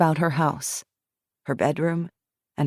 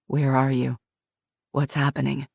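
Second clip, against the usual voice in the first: lower, steady guiding voice; calm questioner; 3 semitones lower than usual. Acted. Jackson guides Shiloh through the memory, low calm prompting. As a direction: gently prompt her to go on.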